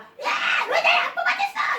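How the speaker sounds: high, loud mock shout; angry outburst. Shown as a demonstration. Teacher showing what sulking is not, acted angry yell. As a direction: yell it like a child throwing a fit. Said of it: "이렇게 막 화를 내는 게 아니라".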